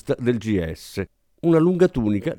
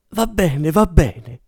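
wheezed reluctance; 4 semitones higher, much slower than usual. Acted. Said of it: "rantolò".

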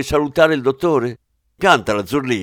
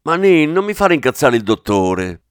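louder sharp rebuke, name called out high; scolding; 4 semitones higher, 7 dB louder than usual. Acted. Zurlini scolds Manin for annoying the boss, loud rebuke. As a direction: call him to order before the boss.

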